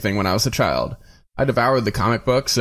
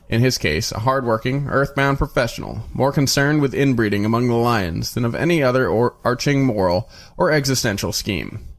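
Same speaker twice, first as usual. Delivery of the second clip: plain reading voice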